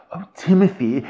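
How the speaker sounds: pleading. Retold or imitated. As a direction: appeal to him as if pleading. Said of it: "is pleading with Timothy".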